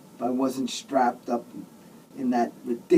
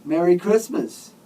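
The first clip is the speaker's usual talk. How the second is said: cheery, higher and louder Christmas greeting; beaming; 7 semitones higher, 6 dB louder than usual. A narrator's reading. Father Murphy greets the patient with his Christmas smile, beaming. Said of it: "Father Murphy beamed his Christmas smile".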